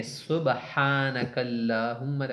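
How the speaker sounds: سبحانک اللہم said very slow with a clear Arabic ح; slow correct Arabic. Shown as a demonstration. Teacher modelling slowly for students. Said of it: "سبحان نہیں ہے".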